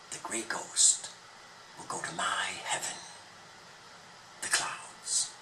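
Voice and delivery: soft, fast whispered promise; God whispering of heaven; hushed whisper. Acted. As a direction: whisper it like a secret blessing. God speaks of souls.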